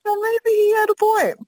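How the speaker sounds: high-pitched, drawn-up mock concession; grudging concession. Adult's reluctant concession, acted.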